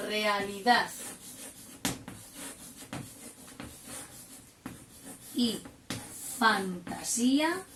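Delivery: each word spaced out for copying; slow dictation. Shown as a demonstration. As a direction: dictate "realidad y fantasía" slowly, pausing between words. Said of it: "qué pongo de enunciado". (dictado lento, palabra por palabra)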